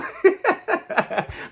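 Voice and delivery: long high laugh. Real, not acted. Laughing after dare.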